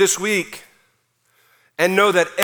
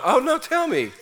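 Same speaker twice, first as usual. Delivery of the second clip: higher, laughing mock innocence; God playing dumb with a laugh; mock-innocent God; 8 semitones higher than usual. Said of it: "Like God's going".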